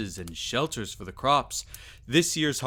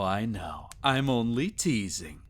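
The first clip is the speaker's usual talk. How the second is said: ordinary talk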